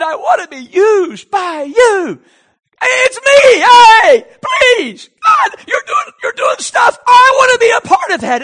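high, full-volume cries to God; shouted pleading prayer. Acted. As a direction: yell for God's attention, insistent and begging. Acts out bold demanding prayer.

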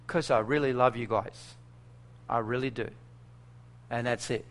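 soft, low, heartfelt; sincere. Retold or imitated. Missionary assuring the village chief of his love, soft and sincere. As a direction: say it gently, meaning every word. Telling chief he loves them.

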